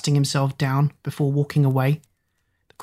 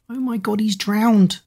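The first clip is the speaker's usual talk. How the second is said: high panicked gasp; sudden fear, voice jumping up; 6 semitones higher, slower than usual. A narrator's reading. Finds overflowing sink, panic.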